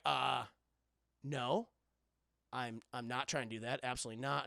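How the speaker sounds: low, soft, measured hero voice; calm denial. Retold or imitated. Jack denies trying to kill the Scotsman, calmly. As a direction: imitate a polite man placating a hothead.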